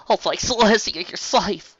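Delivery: voiced with high relief; relieved Applejack. A narrator's reading. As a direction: gasp out the relief. Relieved sister finds Apple Bloom.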